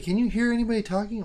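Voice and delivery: high, fast mimicry of the wife; wife teasing him about talking alone; mock wife voice. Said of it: "She's like".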